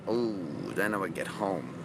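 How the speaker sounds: low, hushed note of dread; ominous foreboding. Shown as a demonstration. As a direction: drop deep and soft, as if trouble waits. Ominous replay of the problem.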